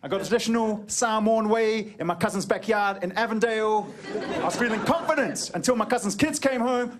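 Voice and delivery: ordinary talk, nothing marked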